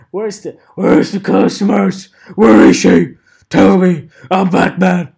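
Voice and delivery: loud, high mock Batman interrogation; shouted Batman impression demanding answers. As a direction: bark it like a hammed-up Batman. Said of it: "I do a good Batman impression".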